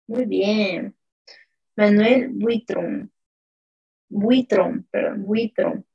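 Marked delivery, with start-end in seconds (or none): none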